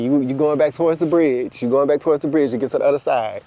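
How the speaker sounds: ordinary talk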